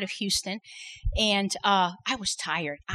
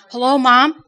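frantic call; 4 semitones higher, 12 dB louder, slower than usual. Retold or imitated. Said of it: "Her voice is frantic".

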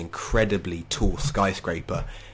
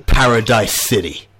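louder, grand announcement of the stand's name; triumphant proclamation; 8 dB louder than usual. Acted. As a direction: proclaim it to the whole city. Villain names his stand triumphantly.

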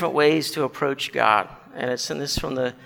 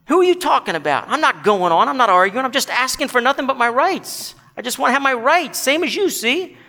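much higher, argumentative protest; indignant ghost; 8 semitones higher, 4 dB louder than usual. Acted. Ghost demands his rights from the bright man, indignant. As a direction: argue back in a high, affronted voice.

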